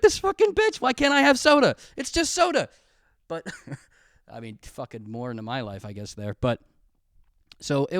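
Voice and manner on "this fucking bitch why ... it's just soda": high, loud mock outrage; a kid squealing at the unfairness; indignant kid outrage. A boy fuming at his dad over soda, shrill mock outrage.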